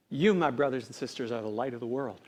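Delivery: hushed reveal. Retold or imitated. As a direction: reveal it quietly and solemnly. Solemn reveal of Jesus' words.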